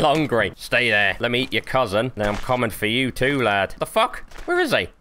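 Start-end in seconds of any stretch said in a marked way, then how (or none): 3.8-4.9 much higher puzzled outburst; startled. Real, not acted. Crab vanished from the fire, startled high outburst. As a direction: yelp it in sudden confusion.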